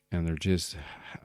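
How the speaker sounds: ordinary talk, nothing marked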